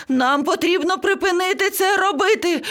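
very high, frantic parrot voice; high-pitched panicked outburst; shrieking in panic. A narrator's reading. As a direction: screech it all out without letup. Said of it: "зарепетував папуга".

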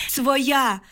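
high-pitched narrated shout, held-back volume; crying out. A narrator's reading. Woman running toward soldiers in the snow, high contained shout. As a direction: call out urgently to be recognised. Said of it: "кричала я".

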